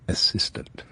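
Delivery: breathy whisper on the word assistant; whispered aside. Acted. Max whispering to the listener about his new assistant. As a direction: let the last word slip out as a sly whisper.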